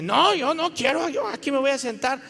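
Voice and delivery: high, insistent objection; defiant refusal. Acted. Congregant refusing a server's seat, defiant.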